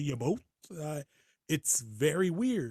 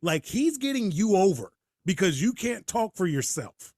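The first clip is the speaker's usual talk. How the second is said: higher and louder, building indignation; exasperated rant; 5 semitones higher, 4 dB louder than usual. Real, not acted. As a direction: rant at the booking, getting worked up. Ranting at the storyline logic.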